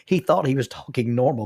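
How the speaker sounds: usual speaking voice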